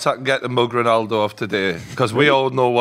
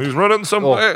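panicked defender; 11 semitones higher, 4 dB louder than usual. Retold or imitated. Defenders panicking, mocked quote.

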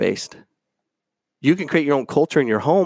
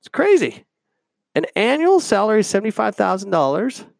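can't believe what you just read; incredulous; 10 semitones higher than usual. Real, not acted. Disbelief at the article.

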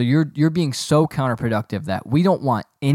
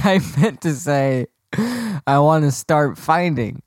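holding back laughter; 2 semitones higher, slower than usual. Real, not acted. Laughing retry.

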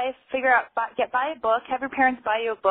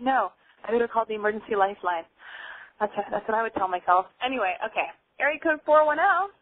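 normal talking voice

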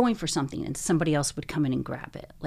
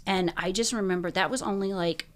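usual speaking voice

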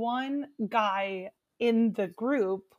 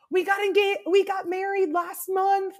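high, bright announcement; excited news; 8 semitones higher, 3 dB louder than usual. Retold or imitated. Stranger tells the group they married, high excited. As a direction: share the news proudly. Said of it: "somebody else was like".

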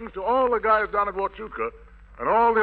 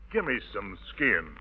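deep, much softer punchline voice; Daniel Boone quote; 11 semitones lower, 6 dB quieter than usual. Acted. Host quotes Daniel Boone to the soldiers, deep and soft. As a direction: drop low for the punchline. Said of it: "as Daniel Boone said to the raccoon".

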